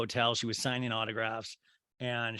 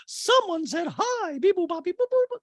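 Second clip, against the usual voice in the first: Dorit; 5 dB louder than usual. Retold or imitated. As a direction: beep it out like a high-pitched robot. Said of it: "And Dorit's like".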